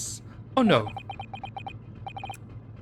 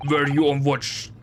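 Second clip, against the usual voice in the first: normal talking voice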